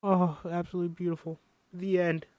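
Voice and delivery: moved, high choked-up voice; tearful. Real, not acted. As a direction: finish the reading close to tears. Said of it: "I told you I was gonna cry".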